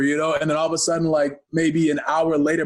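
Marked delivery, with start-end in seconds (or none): none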